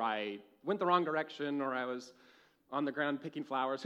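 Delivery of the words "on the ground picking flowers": usual speaking voice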